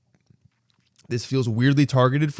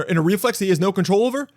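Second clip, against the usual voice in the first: ordinary talk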